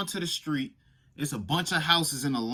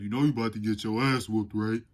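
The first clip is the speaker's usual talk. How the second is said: 1 teasing brother; 6 semitones lower than usual. Retold or imitated. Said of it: "My brother standing at the bottom step".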